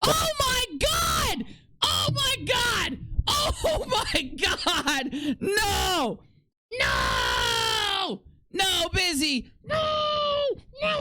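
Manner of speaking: high, drawn-out screams of mock disgust; hammed-up horror. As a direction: overact shrieking at something gross.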